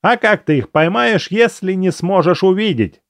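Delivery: high, thin squeaky voice. A narrator's reading. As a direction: pipe it up like a squeaky kid. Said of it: "своим писклявым голосом".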